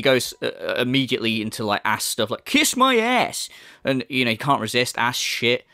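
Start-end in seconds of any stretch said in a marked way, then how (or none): 2.4-3.5 high, drawn-out mock tantrum; mock angry outburst. Commentator mimicking the streamer's retort to a fan, mock angry. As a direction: imitate an angry streamer, slow and pitched up. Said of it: "all this little angry stuff that he does".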